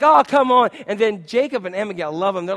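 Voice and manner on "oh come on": loud, higher-pitched retold groan of complaint; acted-out exasperated outburst; exasperated protest. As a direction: exclaim it loudly, fed up.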